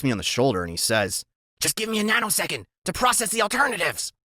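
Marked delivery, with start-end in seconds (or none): 1.6-4.1 Freak's high voice. A narrator's reading. Sidekick patting his friend's shoulder while thinking.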